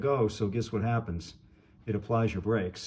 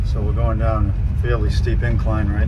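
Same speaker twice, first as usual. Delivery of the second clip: in-car recording; 9 dB louder than usual. Real, not acted. Driving test, recorded in moving car.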